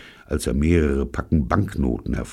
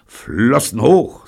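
loud, slow cold command; drawn-out gunpoint order; ice-cold; 9 semitones higher, 10 dB louder, slower than usual. A narrator's reading. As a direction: order it coolly and deliberately. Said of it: "sage ich ganz eiskalt".

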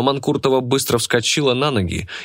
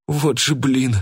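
higher-pitched, stunned exclamation; shocked exhale; 5 semitones higher, slower than usual. A narrator's reading. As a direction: let it out in dismay, without shouting. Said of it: "потрясённо выдохнул Афанасий". (потрясённый выдох)

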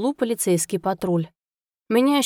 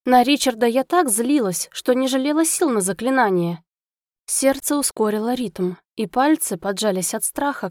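usual speaking voice

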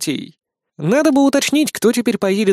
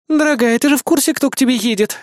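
high, tense, malicious needling; spiteful; 9 semitones higher than usual. A narrator's reading. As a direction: needle her with pitched-up venom. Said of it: "злобно прошипела богиня". (злобно, высоким голосом)

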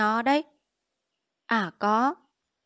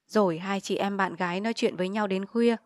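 ordinary delivery

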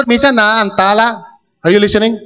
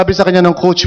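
ordinary talk

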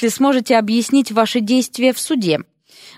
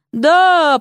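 loud high zombie groan; zombie moan; 8 semitones higher, 4 dB louder than usual. A narrator's reading. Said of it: "простонало оно".

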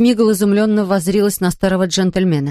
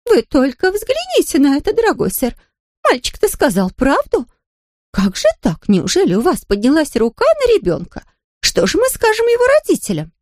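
much higher, dismayed exclamations; aghast at the bruises; shocked housekeeper; 9 semitones higher than usual. A narrator's reading. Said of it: "всплеснула руками". (потрясённо, высоко)

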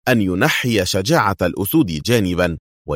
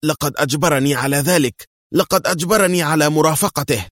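high-pitched, panicked pleading; terrified cry; 9 semitones higher than usual. A narrator's reading. Andre pleads that he was forced, crying out in terror. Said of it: "وهو يصرخ في رعب".

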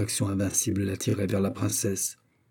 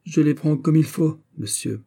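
raised, emphatic tone of defiance; haughty; 6 semitones higher than usual. A narrator's reading. Buckingham taking up de Wardes's words, haughty challenge. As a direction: answer loftily, dropping away on the last word. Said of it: "avec ce ton hautain".

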